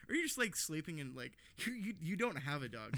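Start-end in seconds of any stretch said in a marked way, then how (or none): none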